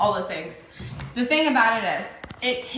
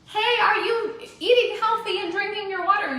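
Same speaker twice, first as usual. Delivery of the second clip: high, sing-song nagging voice, pitched way up; mock nagging check-in; 11 semitones higher than usual. Mocking a pushy recruiter chasing team members, high nagging voice. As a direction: chase someone about their habits in a pushy, high voice. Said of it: "I don't have to go and find them and be like".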